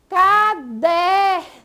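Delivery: "Caderno" in three slow, loud, separate syllables; split into syllables. Shown as a demonstration. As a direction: break "Caderno" apart as ca-der-no, drawing out each syllable. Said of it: "tá dividida essa palavra em três sílabas".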